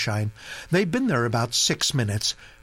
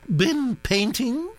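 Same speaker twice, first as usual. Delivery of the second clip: put-on high, brisk aristocratic old lady; old duchess voice; 12 semitones higher, slower than usual. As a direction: gossip like an imperious old duchess. Duchess questions.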